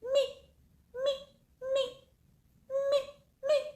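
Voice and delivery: high, tiny chirping meeps; hummingbird meep. Retold or imitated. Imitating the hummingbird that woke her from crying, tiny high meeps. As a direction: squeak it out like a little hummingbird. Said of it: "I hear this meep".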